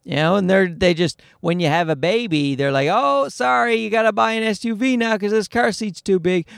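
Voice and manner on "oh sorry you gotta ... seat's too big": high, sing-song fake sorry; mock apology. Carmakers telling new parents to buy bigger, mock-apologetic. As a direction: put on a pitched-up insincere apology.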